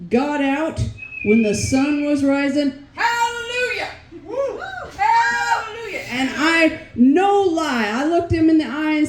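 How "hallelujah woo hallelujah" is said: high, joyful hallelujah cry; jubilant shout. Real, not acted. Celebrating her first all-night romp, jubilant high cry. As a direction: shout it like church praise, high.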